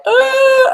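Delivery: high, loud mock scream of panic; terrified shriek. Coach imitating a terrified client, high mock scream. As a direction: squeal like a scared client. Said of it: "they're like".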